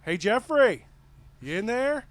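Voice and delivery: put-on high, loud sing-out to a kid; dad calling in. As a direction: call out to your kid through the door. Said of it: "what to be the dad just pop his head in and goes".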